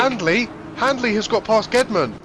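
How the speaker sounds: high, loud excited call; excited shout. Real, not acted. Excited call of lead change.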